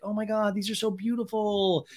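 high-pitched put-on admiration; high, sing-along mock sweetness; mock gushing. Acting [name]'s thanks for flowers.

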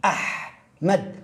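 أحمد said very slowly, each sound drawn out; Ahmad pronounced. Shown as a demonstration. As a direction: ease through أحمد sound by sound. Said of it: "أنت تقول".

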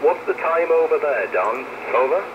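usual speaking voice

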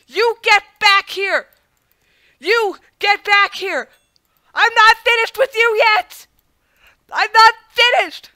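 high, loud, slow furious scream; furious shout. Real, not acted. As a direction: bellow at the enemy who got away. Villain escaping, player yells.

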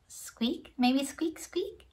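soft, tentative little squeaks; mouse squeaks. Shown as a demonstration. Teacher guesses what a mouse says, squeaking. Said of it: "What sound does a mouse make".

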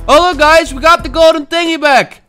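high, loud celebration; excited announcement. Real, not acted. Player showing viewers the regained prize, excited.

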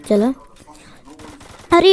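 ordinary delivery

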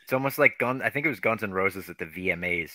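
ordinary delivery

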